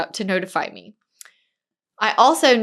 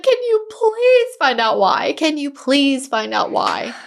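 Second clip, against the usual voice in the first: high, slow pleading voice; desperate plea; 4 semitones higher, slower than usual. Retold or imitated. Seller crying on the phone to her agent, desperate and high. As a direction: beg like someone at the end of her rope. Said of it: "desperation in her voice".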